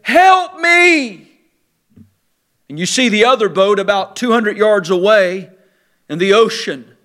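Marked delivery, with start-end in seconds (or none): 0.0-1.3 distant cry for help. Retold or imitated. Preacher voicing a far-off drowning person, distant mock cry. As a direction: call as if from two hundred yards across the water. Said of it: "But there at the distance".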